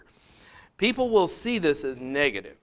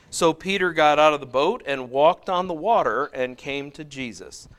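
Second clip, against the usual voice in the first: raised, measured Bible reading; slightly louder scripture reading voice; 3 semitones higher, 6 dB louder than usual. Acted. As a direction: read the passage out to the class. Reads scripture passage aloud.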